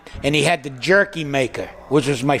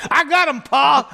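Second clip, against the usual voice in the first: higher, excited shout of I got him, Pa; excited son; 9 semitones higher than usual. Retold or imitated. Son calling to his father in the boat, excited. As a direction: call out proud and excited. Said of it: "And he goes".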